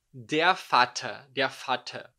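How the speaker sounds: Vater said "fatah", slow and loud. Shown as a demonstration. Teacher shows students V said as F. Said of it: "o V, ele tem som de F".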